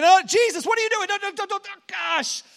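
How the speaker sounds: high, loud, frantic protest; panicked disciples. Acted. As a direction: stop him before he touches the leper. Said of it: "You can imagine the disciples being like".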